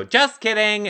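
high-pitched "gotcha" call; mock host reveal. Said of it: "then he should go".

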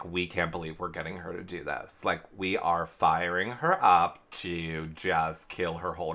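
low, soft conspiratorial aside; hushed, low plotting voice; gloating schemers. Retold or imitated. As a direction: gloat in secret, quietly. Imitating schemers gloating in private.

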